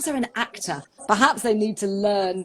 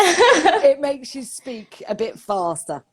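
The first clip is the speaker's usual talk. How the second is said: laughing; 4 semitones higher, 4 dB louder, slower than usual. Real, not acted. Laughing at own nervous fast talking.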